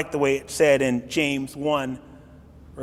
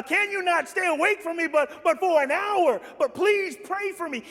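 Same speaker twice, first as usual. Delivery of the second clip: high, pleading voice of Jesus; Jesus begging his disciples to stay awake, in a high pleading voice; 12 semitones higher than usual. Retold or imitated. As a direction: plead gently with sleeping friends. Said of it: "wakes them up and says".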